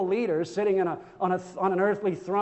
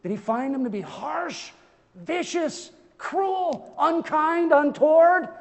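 building disbelief; 6 semitones higher, slower than usual. Acted. Preacher asking how Peter found the Savior, building disbelief. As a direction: climb word by word to an incredulous peak.

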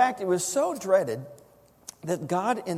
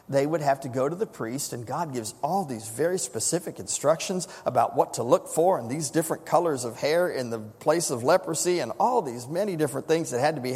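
ordinary delivery

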